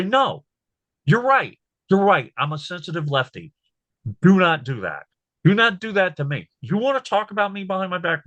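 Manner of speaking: high-pitched, emphatic retort; defiant pushback. Acted. Speaker showing viewers how to refuse their crap, defiant pushback. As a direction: push back firmly, pitched well up. Models standing up to Trump supporters.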